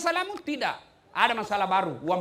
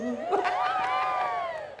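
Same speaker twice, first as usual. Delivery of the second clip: high laugh; 3 dB quieter than usual. Real, not acted. Laughs after his song.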